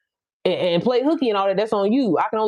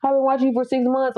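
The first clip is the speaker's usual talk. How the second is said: lightly imitated fan, slightly higher; gushing follower; 4 semitones higher than usual. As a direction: mimic an admirer confessing. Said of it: "so many people tell me".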